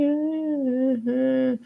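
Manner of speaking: a quiet, breathy laugh at the memory; soft laugh. Real, not acted. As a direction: laugh under the breath as if recalling it. Laughs at own story.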